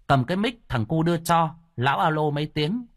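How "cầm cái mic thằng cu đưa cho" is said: plain reading voice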